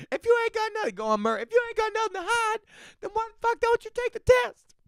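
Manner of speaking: very high squeaky put-on voice; high-pitched mock interrogation; mock accuser. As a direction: push it way up high, hammed-up challenge. Shrill mock accuser in a bit.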